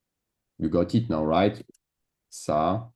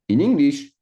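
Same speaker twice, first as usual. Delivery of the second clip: "in English" high and loud; emphatic contrast; 7 semitones higher, 7 dB louder than usual. Shown as a demonstration. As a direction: hit "in English" hard for the contrast. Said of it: "contrasting French and English".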